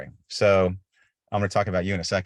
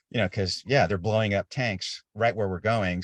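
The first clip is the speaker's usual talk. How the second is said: ordinary delivery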